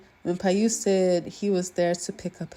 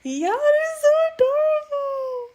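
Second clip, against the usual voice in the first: high squeal of delight, louder; 4 dB louder than usual. Real, not acted. Fan squealing to viewers over the adorable scene, high and loud. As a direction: squeal like a fan who can't take it.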